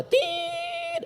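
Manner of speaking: high, soft flatline imitation; long flatline beep. Shown as a demonstration. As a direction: hold a flat monitor tone. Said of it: "Kalau titik itu panjang dia".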